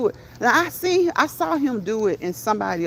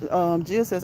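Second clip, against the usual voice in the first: ordinary delivery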